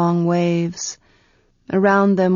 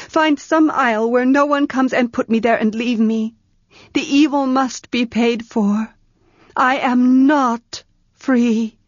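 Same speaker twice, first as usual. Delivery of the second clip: higher voice, slowing to a heavy end; despairing young woman; 4 semitones higher than usual. Acted. Tenar begs Ged to abandon her, higher and slowing. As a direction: she begs to be left alone.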